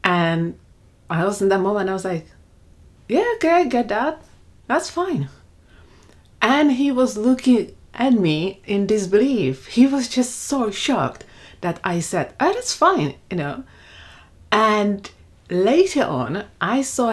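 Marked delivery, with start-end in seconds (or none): 3.1-5.3 high-pitched, easygoing retold reply; breezy acceptance. Stepmom answering a boy who said he hates her, breezy. 12.4-13.2 high, falling, unbothered retold reply; breezy acceptance. Retelling her answer that shocked the boy, breezy.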